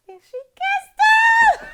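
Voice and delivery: high, loud, drawn-out squeal; thrilled fan scream; excited squeal. Real, not acted. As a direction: shriek the big reveal with delight. Excited retelling of the kiss.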